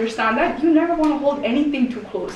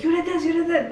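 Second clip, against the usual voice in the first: mock blaming; 7 semitones higher, faster than usual. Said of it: "point fingers and be like".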